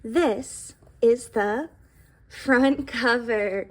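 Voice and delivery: put-on slow and deliberate for young listeners; slow, clear teacher voice; slow teaching. Shown as a demonstration. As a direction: draw each word out like pointing at the page. Teacher naming book parts.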